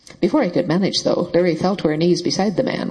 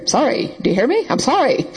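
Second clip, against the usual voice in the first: shouting; 7 semitones higher than usual. A narrator's reading. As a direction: yell the sorry so she hears it. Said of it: "he was shouting in her ear".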